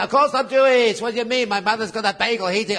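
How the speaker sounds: mock-offended protest at the door; indignant insistence. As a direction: insist you belong, voice up and loud. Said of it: "I say".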